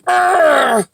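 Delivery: loud, high frustrated growl; long groan of annoyance; exasperated groan. Real, not acted. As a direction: let out a fed-up "urgh". Exasperated at men sending photos.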